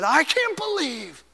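high, slow, awestruck; ecstatic, pitched high; dying man's rapture. Retold or imitated. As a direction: gasp it out as he sees the Lord. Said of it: "with a gasp, he said".

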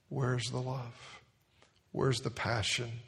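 quiet, very slow plea; soft pleading question. Real, not acted. Preacher asking the church softly where their love went, slow. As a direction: ask it softly and slowly, drawing them in.

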